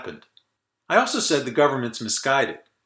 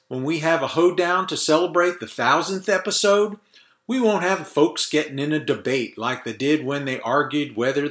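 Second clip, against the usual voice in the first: fake Southern accent; 2 semitones higher than usual. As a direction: play a Yankee faking a Southern accent. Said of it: "that fake Southern accent is tiring to do".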